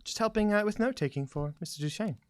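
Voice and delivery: hesitant character. Acted. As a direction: play a tentative, polite townswoman. Game master plays a character.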